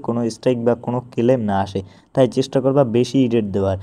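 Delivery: ordinary delivery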